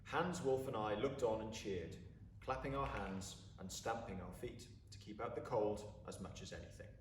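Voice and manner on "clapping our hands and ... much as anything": ordinary delivery, nothing marked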